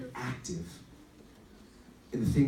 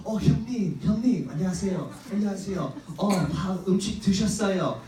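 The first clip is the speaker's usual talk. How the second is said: high, loud eager-to-please Korean politeness; fawning deferential younger kid; overly polite younger brother; 6 semitones higher, 5 dB louder, much faster than usual. Retold or imitated. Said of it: "Bryce in Korea would have to be like".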